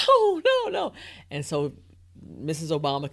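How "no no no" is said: high, loud mock-panicked protest; retold panic. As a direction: cry out in comic desperation. Retelling panic at aide's hurrying.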